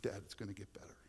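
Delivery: son's whispered comfort. Retold or imitated. Son comforting his crying father, whispered. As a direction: whisper it gently to dad.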